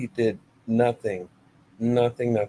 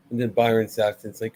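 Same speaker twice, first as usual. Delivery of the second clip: ordinary delivery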